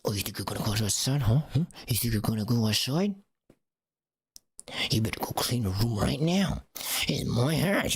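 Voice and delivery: put-on voice of a threatening mom; hammed-up parental telling-off; mock scolding parent. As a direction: challenge the child like an irate parent. Acted parent berating a child.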